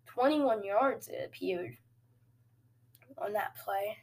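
ordinary talk

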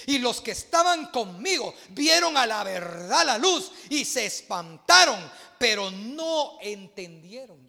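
loud, slow, emphatic preaching; fiery preaching. Real, not acted. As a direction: drive each phrase home to the congregation. Preaches at full force.

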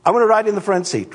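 put-on high, fast kid's whine; whiny kid. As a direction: play a kid demanding the front seat. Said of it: "they're gonna be going".

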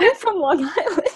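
high-pitched retold protest through laughter; laughing protest. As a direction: laugh while imitating your own indignant reply.

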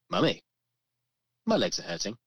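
imitated child, MY stressed, "hurting" lifting; child's complaint, stress on MY. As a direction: lean on MY, then let "hurting" rise. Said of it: "I'm changing the way I say it".

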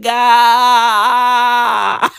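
long wailed cry breaking into stutters; exaggerated bawling, drawn out then choppy; mock baby crying. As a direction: imitate a wailing newborn. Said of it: "This kid is out here crying like".

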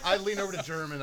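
laughing. Real, not acted. Laughing while speaking.

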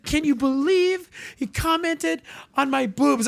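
high mock girl voice; girl complaining. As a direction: imitate her aghast question. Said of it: "She's like".

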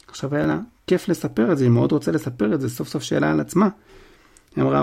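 plain reading voice

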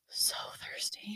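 a dry, whispered complaint. Retold or imitated. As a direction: whisper it like you're parched. Telling how parched she was.

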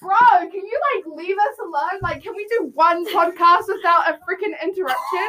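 exasperated complaint. Real, not acted. Telling the intruder off again.

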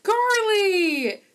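high, loud joyful call of the name; light up answering the phone; excited mom answering. Retold or imitated. Mom picking up her daughter's call, thrilled. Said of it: "and she's like".

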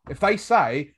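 usual speaking voice

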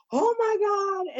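very high, loud imitated shriek; hammed-up high-pitched horror; mock shocked cry. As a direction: shriek it like a stunned onlooker. Retold onlooker's shocked cry.

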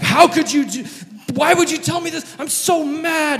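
high, loud furious outburst; angry king. Acted. David the king raging at Nathan's story. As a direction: let the anger explode. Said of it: "And David is mad".

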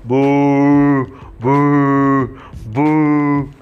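loud, drawn-out booing crowd. Acted. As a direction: boo at them like a bored audience. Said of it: "they start shouting".

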